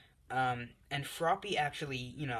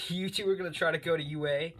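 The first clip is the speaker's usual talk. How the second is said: much higher and louder, scoffing at them; mocking scoff; 6 semitones higher, 6 dB louder than usual. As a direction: laugh them off with a loud jeer. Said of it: "Bakugo laughs, going".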